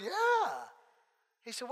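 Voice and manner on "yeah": very high upbeat yeah; the friend answers with a very high yeah; bright yes. Retold or imitated. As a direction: toss off a high happy yeah. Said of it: "And he goes".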